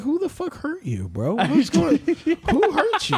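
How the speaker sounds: high, half-laughing who-hurt-you rant; a mock outraged defense of his hero; incredulous defending bro. Defending Steve Irwin to a hater, high laughing indignation. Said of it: "I would just be like".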